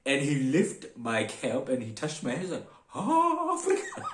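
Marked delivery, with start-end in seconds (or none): 3.0-4.0 very high, loud mock wonder breaking into laughter; amazed exclamation. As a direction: imitate an awestruck conductor, high and laughing. Conductor marvels at the African passenger.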